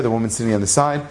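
ordinary talk, nothing marked

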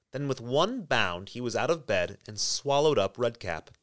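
plain reading voice

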